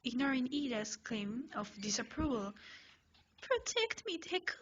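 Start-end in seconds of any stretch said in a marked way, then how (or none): 3.4-4.6 much higher, pleading whine; high, pleading whimper; whimpering plea. Acted. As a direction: beg like a scared child. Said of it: "You whimper".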